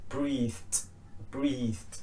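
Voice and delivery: "breathed" ending on a voiceless t. Shown as a demonstration. Teacher modelling T H verbs, T ending. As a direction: say "breathed" as one syllable with a clipped final t. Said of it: "is a T H ending".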